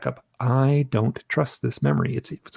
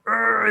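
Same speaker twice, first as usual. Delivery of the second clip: high, strained "ugh"; tense groan; 9 semitones higher than usual. Retold or imitated. Showing how tense he was under hypnosis, high strained groan. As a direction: imitate a groan of extreme tension. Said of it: "as tense as I've ever been".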